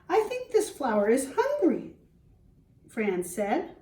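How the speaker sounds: put-on lively read-aloud for young children; storybook reading. Teacher reading to the class, storybook voice. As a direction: read it like a picture book to the class.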